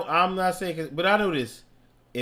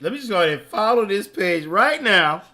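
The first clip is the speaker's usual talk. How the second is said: louder, laughing; higher, louder and laughing; laughing through it; 6 semitones higher, 8 dB louder than usual. Shown as a demonstration. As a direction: laugh while pushing the page. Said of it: "repeating a line in different ways".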